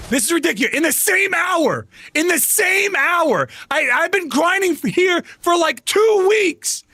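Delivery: high-pitched exasperated yelling, slowing at the end; angry rant. Real, not acted. Streamer complaining to chat about two weeks of grinding, high rant. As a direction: hammer home the injustice.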